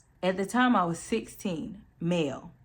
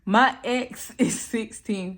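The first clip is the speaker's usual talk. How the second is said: laughing through the retake, higher pitched; 4 semitones higher, 4 dB louder, slower than usual. Real, not acted. Reader retaking a botched line yet again, laughing. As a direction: laugh mid-sentence while drawing the words out slowly. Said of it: "I'm laughing 'cause I messed up".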